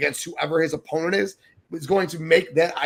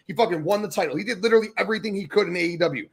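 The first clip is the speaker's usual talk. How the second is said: normal talking voice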